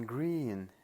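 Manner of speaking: "green" punched loud and drawn out; stress on GREEN. Shown as a demonstration. Lecturer showing what emphasis implies, with stress on "green". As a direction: hit "green" hard. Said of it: "is emphasized".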